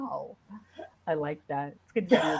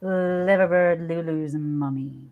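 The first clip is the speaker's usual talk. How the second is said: slow, low, long-drawn hello; low lingering delivery, sinking at the end; heartfelt signature greeting; 5 semitones lower, slower than usual. Shown as a demonstration. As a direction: put your heart into every drawn-out word. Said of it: "really put my heart into it".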